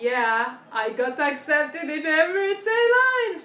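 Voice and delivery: slow, loud, sing-song high announcement; excited announcement. Retold or imitated. Daughter announcing Emirates acceptance to mom, sing-song. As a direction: sing out the big news.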